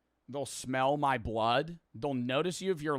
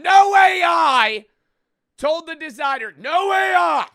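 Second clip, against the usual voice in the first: emphatic shout; 13 dB louder than usual. Real, not acted. Streamer boasts about the designer's work, emphatic shout.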